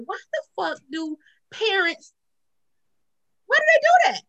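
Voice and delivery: high, incredulous exasperation; exasperated questioning. Retold or imitated. Woman questioning parents' rule on lying, high exasperation. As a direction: climb high in baffled complaint.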